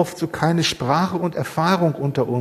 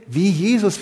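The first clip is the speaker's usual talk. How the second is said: ordinary delivery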